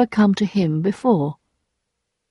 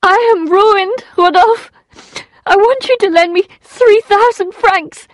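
weeping; 13 semitones higher, 4 dB louder than usual. A narrator's reading. Emma begs Rodolphe for money, weeping. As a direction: plead in distress, pitched right up. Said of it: "began to weep uncontrollably".

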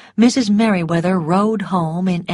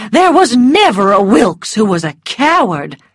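indignant; 4 semitones higher, 6 dB louder than usual. A narrator's reading. Mrs Merriweather outraged.

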